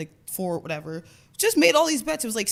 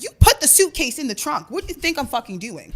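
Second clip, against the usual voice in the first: loud, higher-pitched indignation, easing off; retold exasperated retort; 7 semitones higher, 7 dB louder than usual. Passenger to driver asking if she's leaving, exasperated. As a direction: re-enact snapping at an obvious question.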